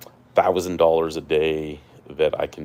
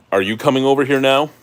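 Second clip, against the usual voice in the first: higher, louder, fast call; calling to the dog; 9 semitones higher, 8 dB louder, faster than usual. Real, not acted. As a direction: call out to someone across the room.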